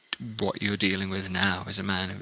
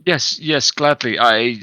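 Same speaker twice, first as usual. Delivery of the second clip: put-on eager old man, rushing to agree; 2 semitones higher, 6 dB louder than usual. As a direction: say it like rescue has come. Old man eagerly accepts escort.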